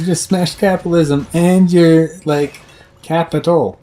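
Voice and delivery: high, loud mock praise; sarcastic cheer. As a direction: congratulate them with sarcasm.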